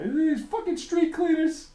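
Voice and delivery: high, loud mock ranting yell. Imitating the comedian ranting about street cleaners, mock yell. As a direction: play an angry comic ranting. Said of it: "rant about".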